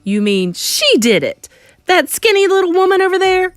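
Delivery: loud, building to a high pitch; incredulous shout. Acted. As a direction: shout in outraged disbelief. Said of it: "shouted Mister Jenkins".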